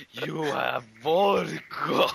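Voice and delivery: very slow, drawn-out menacing growl of a voice; slow haunting boogeyman threat; creepy horror monster. Acted. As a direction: loom out of the dark and creep them out.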